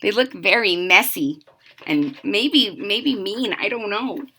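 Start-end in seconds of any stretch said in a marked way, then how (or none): none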